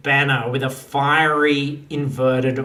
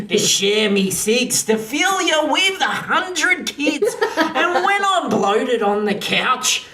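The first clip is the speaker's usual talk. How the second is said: higher, singsong rhyming verse; recited comic wedding vows; 4 semitones higher than usual. Acted. Groom vowing to the bride at the wedding, recited vows. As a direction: recite it like a rhymed love poem.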